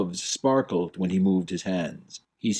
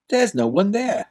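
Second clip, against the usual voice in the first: much higher, louder; puzzled young prince; 10 semitones higher, 3 dB louder than usual. Acted. Prince baffled at the Duke's empty gesture. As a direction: blurt it out, baffled.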